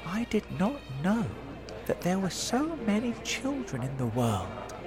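put-on high, light boy's inner voice; gentle, high-pitched wonder; happy thought. A narrator's reading. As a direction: marvel softly to yourself. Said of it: "James thought happily".

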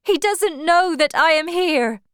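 high, loud, upset woman; cried protest. A narrator's reading. Wife defending absent husband to suitor, upset. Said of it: "she cried".

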